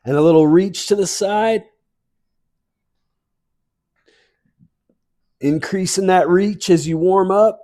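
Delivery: bright, lifted workout cues over music; fitness instructor cueing. Real, not acted. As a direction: call the moves like an aerobics coach. Fitness class movement cues.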